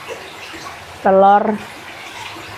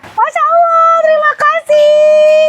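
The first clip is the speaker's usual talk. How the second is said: cute thank-you voice; 4 dB louder, slower than usual. Acted. Thanking buyers in put-on voice.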